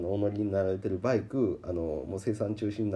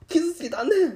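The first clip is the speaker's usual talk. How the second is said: very high, loud petulant squeal; sulking bike voice; 9 dB louder than usual. Retold or imitated. The bike threatens to hurt itself in a petulant squeal. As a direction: spit it out like a spiteful child. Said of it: "拗ねたんや".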